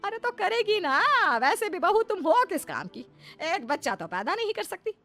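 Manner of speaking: high-pitched, cutting dismissal; scornful taunt. Acted. Mother-in-law taunting childless daughter-in-law, scornful.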